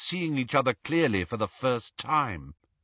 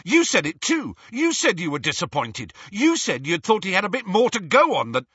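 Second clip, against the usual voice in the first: loud, higher, accusing Ron; angry accusation; 8 semitones higher, 4 dB louder than usual. Acted. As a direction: throw the accusation at her, cut off at the end.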